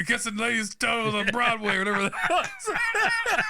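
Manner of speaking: high laughing squeal through the words. Real, not acted. Remembering a film while laughing. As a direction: crack up mid-sentence. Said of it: "me trying to do an Italian accent".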